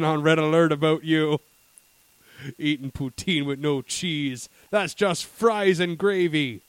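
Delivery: slow, mock-menacing Canadian scolding; Canadian server. Canadian server confronts the man refusing cheese, slow mock-menace. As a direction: draw it out like an affronted Canadian official. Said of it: "they'd be like".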